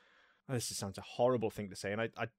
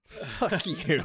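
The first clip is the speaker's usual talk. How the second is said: tossed off through a laugh, a little higher and louder; 6 semitones higher, 7 dB louder than usual. Real, not acted. Co-host laughing back at a playful dig. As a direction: laugh it out as a friendly jab. Mock insult after a jab.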